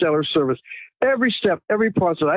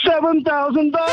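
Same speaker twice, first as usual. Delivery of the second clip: excited shout; 8 semitones higher than usual. Real, not acted. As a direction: shout out the big number with glee. Big reveal of free silver.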